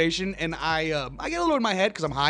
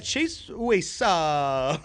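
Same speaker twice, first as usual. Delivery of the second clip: Pauly Shore; much slower than usual. Retold or imitated. Guest repeating the catchphrase for a host who missed it, in impression. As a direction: repeat the goofy catchphrase, pitched up and stretched. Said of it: "saw Pauly Shore".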